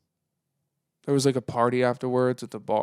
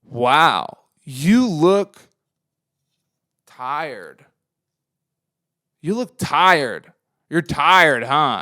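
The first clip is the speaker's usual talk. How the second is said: louder, higher mock of a blunt guy; 3 semitones higher, 7 dB louder, much slower than usual. Guy at the pop-up telling the speaker he looks tired, mocking. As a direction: imitate a tactless stranger, slow and smug. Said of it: "and he says".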